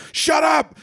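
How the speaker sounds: high, shouted Shut up; mock scream. Friend retelling Tai snapping at Mako, a high mock scream. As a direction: yell it like a cartoon hero. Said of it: "Screams at her".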